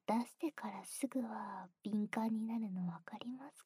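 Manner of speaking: soft knowing whisper; quiet intimate whisper. Acted. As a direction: whisper it understandingly.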